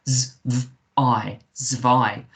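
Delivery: "z, v, I" separately, then "zwei"; I sound. Shown as a demonstration. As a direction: blend buzzing z and v into "zwei", rhyming with eye. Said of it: "we say the I sound".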